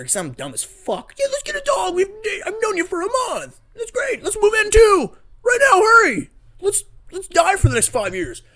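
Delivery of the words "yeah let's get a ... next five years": very high squealed sarcastic enthusiasm, growing loud; mock over-excited. Host mocks his dumb rush to move in, squealing. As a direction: build to a frantic, hammed-up gush. Sarcastically escalating a couple's rash plans.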